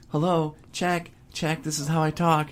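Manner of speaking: high, put-on mom voice; imitating mom's mic check. Host mimicking mom testing the microphone, high put-on voice. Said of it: "the queen of testing the microphone, like".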